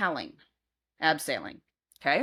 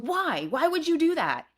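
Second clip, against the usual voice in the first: high, accusing plea; upset demand; 10 semitones higher than usual. Retold or imitated. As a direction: ask why, hurt and angry. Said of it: "I said".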